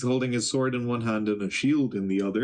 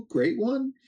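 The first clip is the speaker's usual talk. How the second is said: fawning greeting; 13 semitones higher than usual. Acted. Summers greeting the Great One by snail phone, high fawning lilt. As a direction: voice leaps up much higher on the title.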